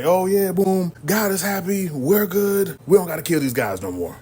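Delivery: high, loud mock proclamation; proclaiming leader. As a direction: announce good news to the crowd as a leader. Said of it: "the leaders say".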